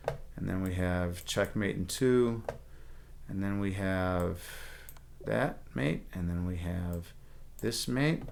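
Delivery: soft, slow murmur while thinking; focused quiet muttering. Real, not acted. Streamer working through chess puzzles, murmuring softly. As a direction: mutter to yourself solving puzzles. Solving puzzles under time.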